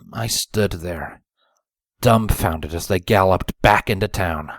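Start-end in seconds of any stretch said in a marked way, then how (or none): none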